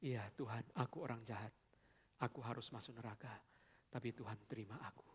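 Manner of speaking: soft, low broken confession; humble prayer. Acted. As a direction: pray it like a sinner on his knees. Voicing convert's prayer.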